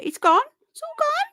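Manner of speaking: put-on high rising baby-talk wail; playful squeal to the pet that the food is gone; mock dismay. Pet finished the carrot, playful mock lament.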